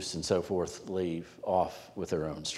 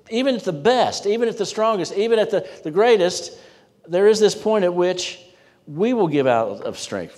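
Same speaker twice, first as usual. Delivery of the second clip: high, loud preaching at full force; emphatic preaching; 9 semitones higher, 6 dB louder than usual. Acted. Preacher driving home human weakness, high and loud. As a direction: preach it out big and high to the room.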